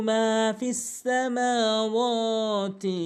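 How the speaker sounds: মা and ওয়া held one alif, slow chant; stretched ma. Shown as a demonstration. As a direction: let মা ring long. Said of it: "মিম এবং ওয়াও এক আলিফ করে টানবেন". (মা ও ওয়াও এক আলিফ টেনে)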